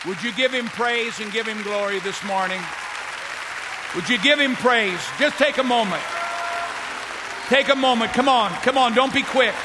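high, loud fervent exhortation; rally the whole room to praise; preaching at full force. Acted. Pastor urging the church to thank God, full-force preaching.

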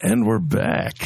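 low, husky smoker's rasp. Real, not acted. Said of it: "Who is this husky voiced gentleman?".

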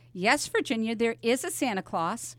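ordinary delivery

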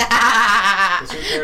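loud laughing dismissal. Real, not acted. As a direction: laugh it off loudly.

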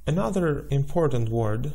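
normal talking voice

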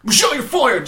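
hammed-up repeated boss yell; looped firing shout. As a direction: play it like a looping tape. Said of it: "Just over and over".

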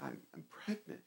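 halting, very low and soft; barely audible imitated admission; retold tearful confession. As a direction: quietly act a tearful confession. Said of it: "she just started crying".

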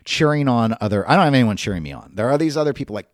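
ordinary talk

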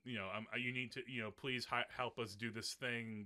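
usual speaking voice

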